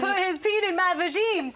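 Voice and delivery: laughing. Real, not acted. Crude joke through laughter.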